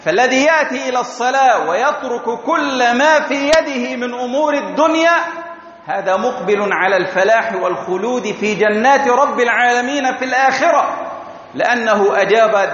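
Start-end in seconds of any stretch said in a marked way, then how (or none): none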